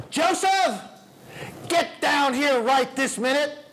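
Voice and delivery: put-on loud, high calling out to a child; father calling his son down, angry yell; stern parent yell. Shown as a demonstration. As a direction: shout the boy's name, he's in trouble. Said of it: "in this tone, this volume".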